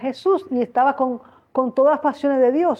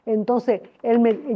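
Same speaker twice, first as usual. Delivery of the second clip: ordinary talk, nothing marked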